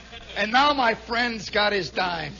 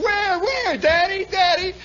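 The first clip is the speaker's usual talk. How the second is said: put-on higher kid voice, pestering Daddy; a little boy nagging, pitched up; whiny kid; 10 semitones higher, slower than usual. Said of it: "He says".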